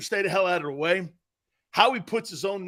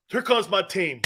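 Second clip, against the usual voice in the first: ordinary talk, nothing marked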